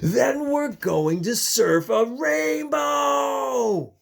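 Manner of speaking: slow, loud, high thrilled call; rainbow plan shout. Acted. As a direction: shout the plan like a big surprise. Narwhal's grand rainbow plan.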